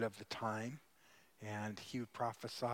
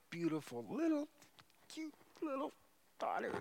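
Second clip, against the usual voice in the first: doting; 13 semitones higher than usual. Real, not acted. Father doting on his little daughter, high tender coo.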